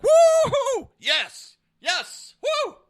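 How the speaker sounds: very high, loud whoops of triumph; acted cheering. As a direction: cheer wildly as if celebrating. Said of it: "I would have been like".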